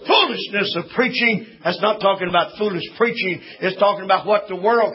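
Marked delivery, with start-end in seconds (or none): none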